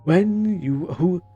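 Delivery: slow, lofty menacing villain; a cult leader preaching calmly. Acted. Voicing villain dialogue.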